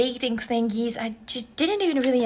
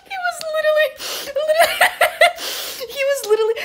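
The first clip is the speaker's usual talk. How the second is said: high-pitched, laughing through the words; helpless real laughter; much faster than usual. Real, not acted. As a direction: try to explain but keep cracking up. Still cracking up while retelling.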